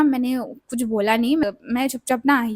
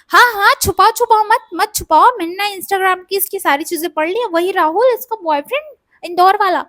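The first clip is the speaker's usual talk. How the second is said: louder, higher jeering accusation; mock-accuse her of hiding it; retelling the husband's loud gotcha; accusing husband; 6 semitones higher, 5 dB louder than usual.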